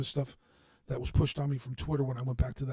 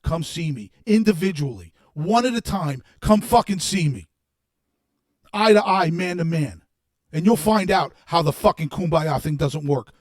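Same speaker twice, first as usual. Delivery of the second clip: furious shouting; 2 semitones higher, 9 dB louder than usual. Real, not acted. Daring online critics to face him, furious shouting. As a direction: tear into them at full volume.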